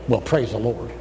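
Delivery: low, subdued praise; underplay it, soft and low, as the Marine; Marine drill instructor imitation. Imitating the Marine instructor praising the Lord, low and restrained. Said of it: "he prays the Lord like this".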